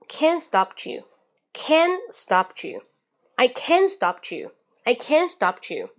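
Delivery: slow "can't stop you" with CAN'T high and its T dropped; silent T in "can't". Shown as a demonstration. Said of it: "can，T不读". (can't 的 T 不读，慢速连读 stop you)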